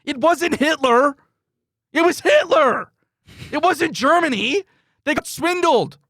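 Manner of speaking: high, loud, slow heated rant; yelling. Real, not acted. Ranting at his co-host about Russia, real yelling. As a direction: hammer it home, really yelling. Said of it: "pardon me for yelling".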